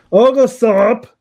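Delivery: German accent, pitched high. Shown as a demonstration. Voice actor showing his German-accented character. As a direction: slips into the Oglethorpe German accent. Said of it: "I use a German accent for Oglethorpe".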